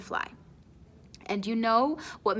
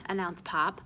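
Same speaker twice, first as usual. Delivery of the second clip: ordinary delivery, nothing marked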